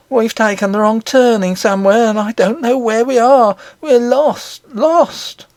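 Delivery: a lost nurse crying out in terror; panicked nurse. A narrator's reading. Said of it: "looked wildly about her, gave a great cry".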